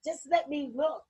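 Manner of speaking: play-acted child pleading to be allowed; pleading daughter. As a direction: wheedle Dad with insistent, stammered buts. Acting daughter begging her father.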